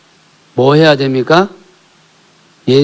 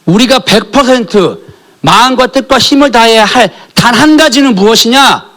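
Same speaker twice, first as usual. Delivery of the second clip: higher, louder and slow at first, driving the point home; fervent sermon climax; full-force preaching; 10 semitones higher, 7 dB louder than usual. Acted. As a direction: preach it out at the congregation.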